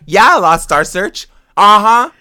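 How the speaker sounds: loud, high, proud and playful; sassy brag. Retold or imitated. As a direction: announce the loss like a victory. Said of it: "and she was like".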